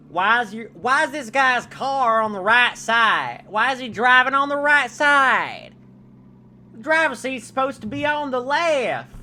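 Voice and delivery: loud, high exaggerated southern drawl; southern accent. Streamer heckling Professor Layton about his driving, exaggerated southern accent. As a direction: holler it in a hammed-up southern voice. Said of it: "That's my southern voice".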